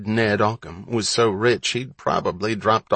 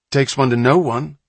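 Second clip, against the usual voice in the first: cheeky comeback; 4 semitones higher, 5 dB louder than usual. A narrator's reading. Kid retorting to insult.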